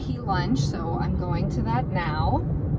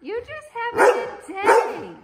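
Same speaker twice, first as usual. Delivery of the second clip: high pet talk; 12 semitones higher than usual. Real, not acted. Owner teasing her argumentative dog in baby talk.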